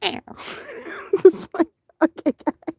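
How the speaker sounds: breathy laughter through the words; laughing. Real, not acted. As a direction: giggle it out. Laughing at the cat.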